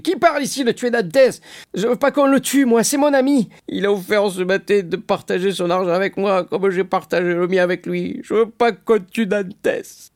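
put-on high-pitched drunkard protesting loudly; drunken protest. Drunk Caderousse defends his friend Dantès, in high drunken protest. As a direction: slur it high and indignant like a tipsy friend. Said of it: "des yeux lourds et hébétés".

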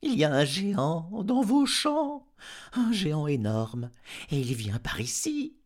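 high, loud dog voice; alarmed dog. A narrator's reading. Dog warning master of a giant in his fields, alarmed. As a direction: sound the alarm as a frantic dog.

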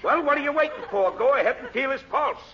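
high, quick exasperated order; impatient outburst. Acted. Gunman ordering the stalling doctor to start, impatient outburst. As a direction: push him to get moving.

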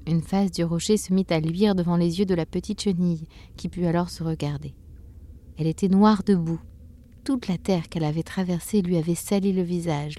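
ordinary delivery, nothing marked

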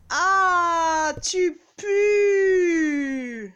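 loud, high cry of disgust; disgusted shout. Acted. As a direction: recoil loudly from the stink. Disgusted at the smell.

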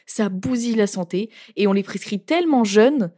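usual speaking voice

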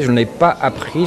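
normal talking voice

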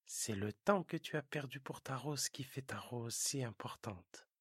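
hushed fox secret; whispering fox. Acted.

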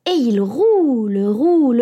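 plain reading voice

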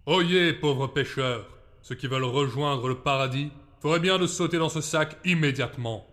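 booming preacher. A narrator's reading. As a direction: intone it like a doom prophet. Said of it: "Il psalmodia son discours".

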